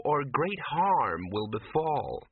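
slow, sweet, dreamy foreboding; lightly voiced entranced woman, sugary warning; sweetly. A narrator's reading. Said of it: "she said sweetly".

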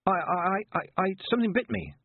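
high, stammering excuse; flustered lie. A narrator's reading. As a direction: stumble over the start as you invent an excuse. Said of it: "lied Tiffany".

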